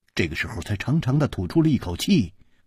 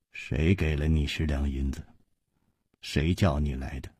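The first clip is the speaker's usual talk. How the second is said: lower, softer, stern questioning; frowning question; 8 semitones lower, 8 dB quieter than usual. A narrator's reading. Chu Liuxiang frowning while questioning the peddler, low and soft. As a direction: press him quietly with a frown. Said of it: "皱着眉问".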